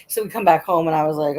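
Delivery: ordinary talk, nothing marked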